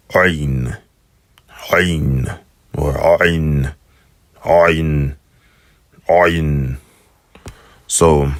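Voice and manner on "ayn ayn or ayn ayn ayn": "Ayn" said with a throaty AW onset; guttural ayin. Shown as a demonstration. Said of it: "the ayin has a sound".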